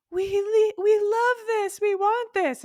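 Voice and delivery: high, loud, insistent outburst; body yelling. Acted. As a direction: cry out as an over-eager body demanding it. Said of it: "why does she have to yell so loud".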